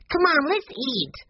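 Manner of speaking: put-on high child voice, drawn out; eager little boy. A narrator's reading. Benny urges everyone to supper, high eager voice. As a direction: urge them high and slow, like a small boy.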